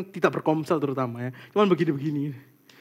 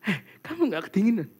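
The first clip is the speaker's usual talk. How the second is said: mom's voice; 3 semitones higher, 4 dB quieter than usual. Retold or imitated. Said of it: "mama aku sampai bilang".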